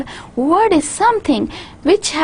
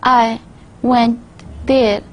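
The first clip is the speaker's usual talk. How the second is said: "I", "went", "there" each set apart by a pause; pauses between words; slower than usual. Shown as a demonstration. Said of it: "I would create pauses".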